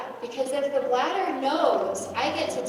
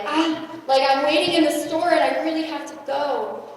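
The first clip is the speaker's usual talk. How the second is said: higher, louder mock panic; hammed-up urgent plea; panicky; 5 semitones higher, 6 dB louder than usual. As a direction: act out losing it, desperate to go. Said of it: "your brain is starting to lose it".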